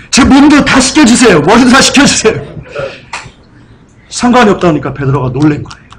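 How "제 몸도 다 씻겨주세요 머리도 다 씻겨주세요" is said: acting out a startled, higher-pitched plea. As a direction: plead in sudden alarm, imitating the disciple. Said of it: "놀랬어요".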